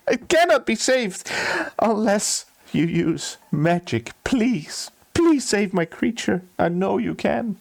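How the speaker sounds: high, incredulous wail sinking into begging; hammed-up tearful outburst; distraught crying. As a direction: sob it out, then plead for the creature. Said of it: "he starts crying and sobbing".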